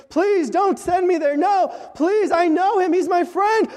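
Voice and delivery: imitating a frightened plea, mild mock scream; desperate pleading. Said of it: "as they're screaming, they're saying".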